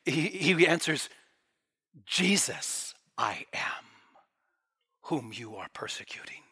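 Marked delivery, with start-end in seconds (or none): 2.1-6.4 hushed emphatic answer. Shown as a demonstration. Jesus answering Paul's question, hushed and solemn. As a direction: put "Jesus" first, then drop low and soft. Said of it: "You could read it like this".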